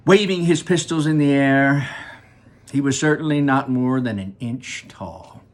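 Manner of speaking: plain reading voice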